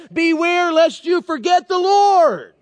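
slow, loud, high proclamation; solemn warning. Acted. Preacher quoting God to the congregation, slow and loud warning. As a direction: lay each word down slowly as God's warning.

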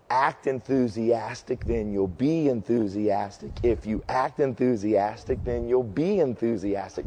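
slow, steady walkthrough of the cheer, at x0.6; slow demo of the cheer. Shown as a demonstration. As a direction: spell the chant out slowly for them. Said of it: "I'm just gonna walk through this".

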